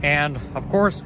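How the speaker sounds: normal talking voice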